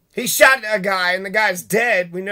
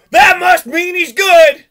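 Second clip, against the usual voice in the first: mock believer; 8 semitones higher, 9 dB louder, slower than usual. Mocking a believer's logic.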